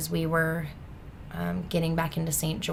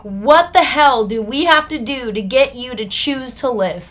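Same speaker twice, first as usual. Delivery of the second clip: loud, higher, emphatic demand; exasperated outburst; 5 semitones higher, 10 dB louder than usual. Retold or imitated. Officer banging the desk at his relapsed client, retold exasperated outburst. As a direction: imitate a fed-up officer exploding at you. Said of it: "banged his hands on the desks".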